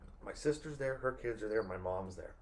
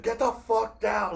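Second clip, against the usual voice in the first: put-on higher, louder, slow angry yell at the kids; mock cussing yell; 10 semitones higher, 7 dB louder, slower than usual. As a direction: bark it at the kids like a fed-up mom. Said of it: "she started cussing. She's like".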